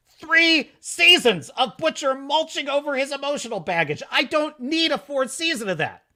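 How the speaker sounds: exasperated rant. Retold or imitated. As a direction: vent like a fed-up fan. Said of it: "I'm like".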